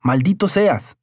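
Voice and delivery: angry shout. A narrator's reading. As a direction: yell the curse as you storm out. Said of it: "Carlos gritó". (grito furioso, más agudo)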